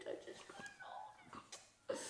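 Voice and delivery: a guilty puppy whispering its excuse; whispered dog plea. Retold or imitated. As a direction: let the plea fade into a tiny whisper. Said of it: "They're like".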